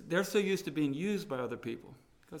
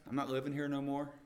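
ordinary talk

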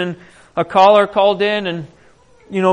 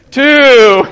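called-out count, much louder; 4 semitones higher, 9 dB louder than usual. Retold or imitated. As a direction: announce each dip to the crowd. Counting dips in the river.

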